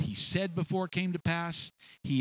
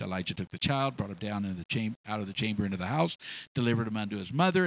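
ordinary talk

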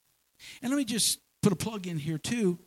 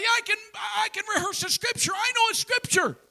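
higher, louder, bursting with pride; proud excited claim; 4 dB louder than usual. Pretend. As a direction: shout it like a proud new believer. Proud new believer telling someone.

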